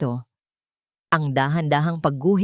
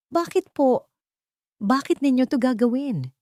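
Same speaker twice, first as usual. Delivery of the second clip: lightly voiced woman, higher and unsure; a hint of doubt in a raised voice; doubtful question; 7 semitones higher than usual. A narrator's reading. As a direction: ask it with hesitant disbelief. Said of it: "Ang boses ay puno ng pag-aalinlangan".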